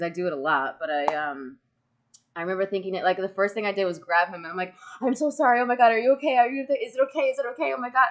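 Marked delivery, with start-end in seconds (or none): 5.0-8.1 higher, louder, rushed mock panic; retold panic. Recalling apologising to the wrestler after the slap, mock panicked and high. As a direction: replay the frantic apology. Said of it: "I was freaking out".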